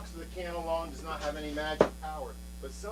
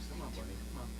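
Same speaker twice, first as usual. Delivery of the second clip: coaxing softly; 6 semitones lower, 10 dB quieter, much faster than usual. Acted. Coaxing a tiny bunny.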